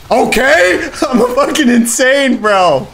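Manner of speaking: very high, loud yelling broken by laughter; real ecstatic yelling. Real, not acted. Streamer amazed at his own insane play, ecstatic yelling. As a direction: scream in disbelief at your own play.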